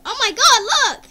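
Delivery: high, loud delighted cry; excited. Real, not acted. Thrilled at the webcam effect, a loud excited shout. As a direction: squeal at the screen.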